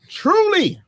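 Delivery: high, loud announcer call; sponsor shout-out. Pretend. Host giving a shout out to sponsor, loud and high. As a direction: belt out the brand name high.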